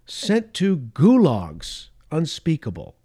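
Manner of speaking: normal talking voice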